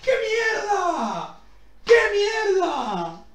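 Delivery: high, drawn-out falling howls; despairing wail. Real, not acted. Streamer laments a rotten reward, despairing wails. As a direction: wail each curse slowly, letting it slide down.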